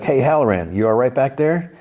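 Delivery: lightly acted checking-in call; retold calling out. Retold calling to man behind him.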